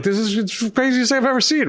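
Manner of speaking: starstruck excitement. Retold or imitated. Eighteen-year-old seeing the band arrive, squealing with excitement. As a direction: gush like a kid seeing his idols. Heroes step out of elevator.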